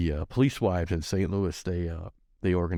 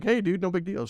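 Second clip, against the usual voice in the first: carefree; 12 semitones higher than usual. Retold or imitated. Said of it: "I thought it was like".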